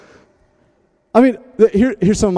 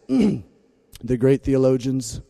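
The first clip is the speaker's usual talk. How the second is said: slow, deadpan grand citation; measured mock reverence with long pauses; mock-solemn; 7 semitones lower, much slower than usual. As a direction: quote a party lyric like weighty theology. Mock-solemn quote of rap lyric.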